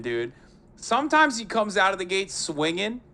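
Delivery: usual speaking voice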